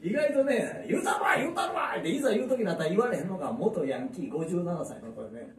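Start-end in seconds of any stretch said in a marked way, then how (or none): 0.9-2.0 boasting. Retold or imitated. Boasting he'll tell her off.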